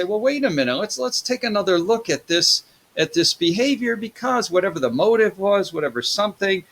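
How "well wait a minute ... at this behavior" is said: lightly voiced second thought, pitched up; rationalizing voice. Lecturer voicing someone who reconsiders, higher. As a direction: talk yourself round, a bit higher. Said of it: "if we say".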